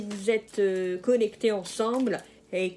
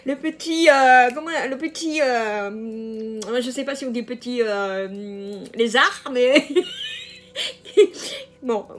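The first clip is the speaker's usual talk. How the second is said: louder, halting, breaking into real giggles; joking, amused; 3 semitones higher, 6 dB louder than usual. Real, not acted. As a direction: fumble for a cheeky euphemism, then crack up. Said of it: "un peu d'humour dans".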